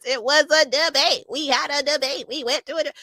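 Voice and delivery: high, light imitation of a politician brushing off a question; Kamala Harris impression. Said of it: "she starts laughing, just cackling".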